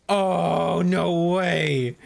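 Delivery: can't believe the luck; excited disbelief. Real, not acted. Reacting to rare drop.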